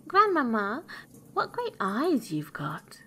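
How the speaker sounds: put-on amazed little girl. A narrator's reading. Girl marvels at granny in bed, high and amazed. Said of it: "being greatly amazed to see".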